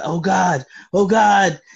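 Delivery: loud, high cries of dread; acted panic. Villain sinking into the ground, panicking.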